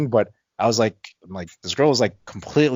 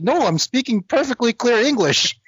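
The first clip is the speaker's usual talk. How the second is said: much higher mock girl voice, protesting; indignant girl; 13 semitones higher than usual. Girl insisting to the drunk guy she's foreign, higher and indignant. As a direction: squeak it up, offended.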